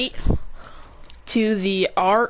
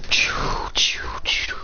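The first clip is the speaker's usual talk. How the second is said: whispered shooting noises; three airy "choo" sound effects; imitated shots; much slower than usual. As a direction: make hushed projectile noises with the breath.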